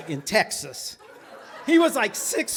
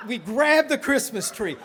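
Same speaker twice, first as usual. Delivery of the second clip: ordinary delivery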